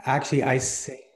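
ordinary talk

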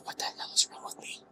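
muttered under the breath; whispered self-scold. Real, not acted. Gamer muttering at own mistake, whispered.

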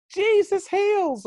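high, loud, drawn-out proclamation; stretched high-pitched cheer; slow excited shout. Retold or imitated. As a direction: holler it slowly for someone with little English. Shouting to celebrating stranger.

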